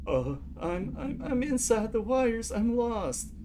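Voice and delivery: high, slow, lost woman's voice; a scared caller drifting away; frightened caller. Acted. Caller in transcript read aloud.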